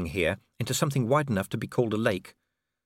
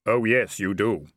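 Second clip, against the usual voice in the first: drawn-out reaper musing; grim reaper voice; 2 semitones lower than usual. Acted. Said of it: "said Death".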